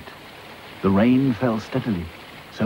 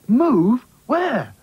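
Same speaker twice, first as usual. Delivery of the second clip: high, louder startled question; surprised cry; 6 dB louder than usual. Acted. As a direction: caught off guard by the news. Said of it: "cried all the Raggy Dolls".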